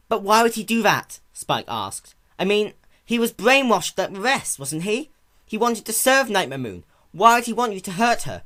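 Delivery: high and loud, urgent; loud worried questioning; alarmed questions. Acted. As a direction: demand answers in disbelief. Alarmed young dragon questioning.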